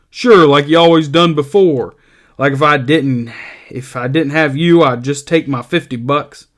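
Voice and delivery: eager and simple; Lenny prodding George to scold him. Acted. Lenny voiced in dialogue.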